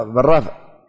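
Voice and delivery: normal talking voice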